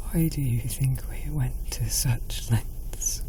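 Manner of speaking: high, hushed, slow fearful whisper; frightened whisper. Acted. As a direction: plead it in a scared whisper. Queen pleading in whisper.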